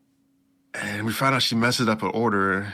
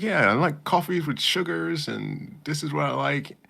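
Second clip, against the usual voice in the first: put-on girlish character; 7 semitones higher than usual. Voicing the heroine's line.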